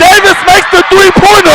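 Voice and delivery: screamed high and loud; ecstatic shout. Real, not acted. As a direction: roar the winning call. Buzzer-beater goes in.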